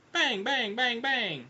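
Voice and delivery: high, ringing shouted bangs; voiced gunshots. A narrator's reading. Narrator sounding Foyle's four shots into the creature, high bangs. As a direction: call out each gunshot high and even.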